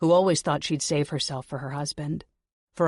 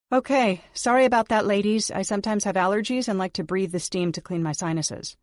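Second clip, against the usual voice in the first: higher and louder, overly bright apology; falsely cheery excuse; 5 semitones higher, 4 dB louder than usual. Acted. Heroine lying to the maids, bright and cheery. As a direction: cover the lie with brightness.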